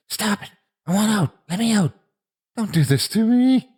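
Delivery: high-pitched panicked yelling; desperate yell. A narrator's reading. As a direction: yell to be let out. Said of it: "he yelled".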